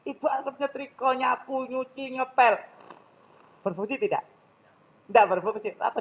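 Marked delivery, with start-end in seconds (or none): 0.0-2.6 keluhan ibu dengan nada tinggi; ibu mengomel. Retold or imitated. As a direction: ulangi omelan ibu yang repot. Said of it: "Jenengan nyampaikan tadi". (ibu mengeluh soal pekerjaan rumah, nada tinggi)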